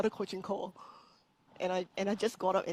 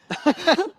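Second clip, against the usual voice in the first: sudden loud laugh, pitched up; a big embarrassed laugh bursts out; laughing hard at her own nerves; loud burst of laughter; 9 semitones higher, 13 dB louder than usual. Real, not acted. Laughs at choking on first live.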